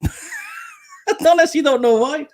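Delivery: laughing through the words, high and slowed; words breaking into a high laugh. Real, not acted. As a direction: giggle it out, embarrassed at yourself. Laughing at his own name slip.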